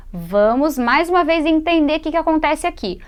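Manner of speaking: usual speaking voice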